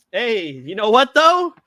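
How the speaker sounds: loud, high and slow, showing off his own photo; excited. Real, not acted. Host showing the co-host his edited photo, excited. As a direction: point at the picture, thrilled.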